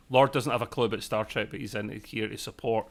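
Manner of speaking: ordinary delivery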